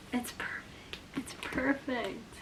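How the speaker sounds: hushed, breath-only amazement; awed whisper. Real, not acted. Reader overwhelmed by a perfect replacement book, awed whisper. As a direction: whisper it, stretching the second time.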